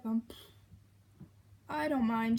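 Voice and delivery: usual speaking voice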